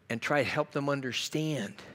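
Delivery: usual speaking voice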